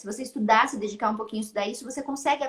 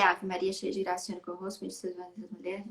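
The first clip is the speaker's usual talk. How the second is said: put-on child's prayer, murmured low and softer; murmured prayer recitation; 4 semitones lower, 6 dB quieter, faster than usual. Son reciting the Ave Maria to his mother, murmured softly. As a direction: recite it quietly, like a child by heart.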